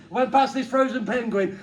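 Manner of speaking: ordinary talk, nothing marked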